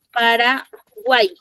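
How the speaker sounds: "Paraguay" drawn out slowly, pa-ra-wai, final y as a vowel; "Paraguay" sounded out at half speed for students; slow pronunciation demo. Shown as a demonstration. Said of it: "Suena así, en la pronunciación".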